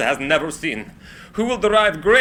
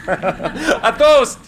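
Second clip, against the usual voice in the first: excited toast; 9 semitones higher, 5 dB louder than usual. Acted. Raising a toast.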